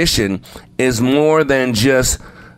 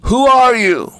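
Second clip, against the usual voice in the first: high call bouncing back; echoing a mountain shout; echoed yell; 10 semitones higher, 4 dB louder than usual. A narrator's reading. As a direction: throw the same call back, high, falling at the end. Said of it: "back came the voice".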